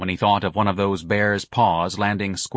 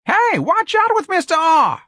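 very high, loud alarmed yell; shrieked, drawn-out warning; screaming; 6 dB louder, slower than usual. A narrator's reading. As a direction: scream a warning across the room. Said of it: "someone screamed".

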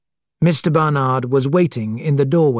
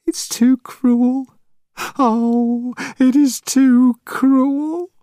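high, slow tearful lament; 10 semitones higher, much slower than usual. Acted. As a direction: sob out the words. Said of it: "she said in a tearful voice".

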